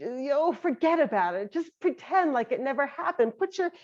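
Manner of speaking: high, airy, dismissive voice; hammed-up breezy ostrich brushing it off; flighty ostrich. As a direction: wave the problem off, flighty and fast. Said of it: "And the ostrich".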